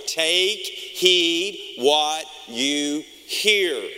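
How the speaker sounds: very slow, weighty words; slow emphatic quote. Acted. Preacher repeating his yearlong warning to the church, slow and weighty. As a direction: lean on every word.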